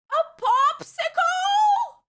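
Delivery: high, slow, overjoyed shout; hammed-up childlike amazement, drawn way out; drugged-out delight. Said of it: "I drugged out, drugged out".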